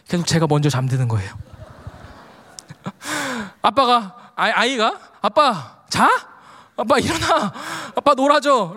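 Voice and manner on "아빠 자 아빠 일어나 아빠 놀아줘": higher-pitched imitation of a pleading child; mildly raised, mock child's call. Son trying to wake his dozing dad, calling out. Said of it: "라고 외치는데도".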